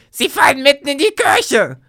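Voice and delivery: high, loud alarmed scream. Acted. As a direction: cry out as the dragon falls on the church. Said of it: "Bibi schrie".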